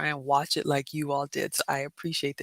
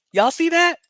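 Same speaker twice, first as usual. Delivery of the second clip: higher, louder, laughing excitement; more excited; 11 semitones higher, 7 dB louder than usual. Real, not acted. Showing viewers the rat clip again, more excited. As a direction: insist with mounting glee.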